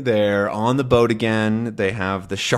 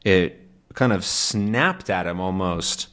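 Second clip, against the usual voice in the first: ordinary talk, nothing marked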